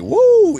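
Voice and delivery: loud, high-pitched "woo"; high mock whoop. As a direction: whoop like an excited islander, mockingly. Mimics islander's excited whoop.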